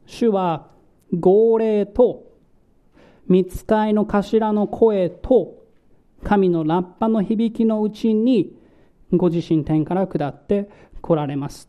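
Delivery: very slow, each phrase weighed; slow emphatic reading. Shown as a demonstration. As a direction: spell the three phrases out slowly for emphasis. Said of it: "三つの言葉を用いていました". (ゆっくり一語ずつ強調して)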